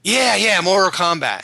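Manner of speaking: loud, high burst of recall; excited recognition. Real, not acted. Suddenly recalls the game's name.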